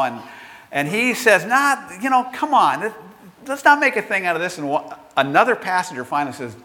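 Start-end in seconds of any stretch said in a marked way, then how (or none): 1.5-4.6 high-pitched, quick dismissive protest; retold brush-off. Seated man won't give up the seat, retold brush-off. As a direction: imitate a man waving off a fuss.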